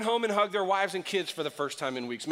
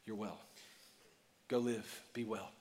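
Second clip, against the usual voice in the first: gentle Jesus; 8 semitones lower, 12 dB quieter than usual. Retold or imitated. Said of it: "He says".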